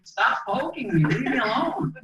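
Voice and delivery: brushing off the medical staff in mock irritation; mock annoyed. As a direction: fend them off with a hammed-up grumble.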